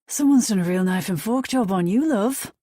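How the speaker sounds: a London hairdresser teasing her, broad British; strong British accent. A narrator's reading. Stylist teasing Daisy in a strong British accent. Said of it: "he says in a strong British accent".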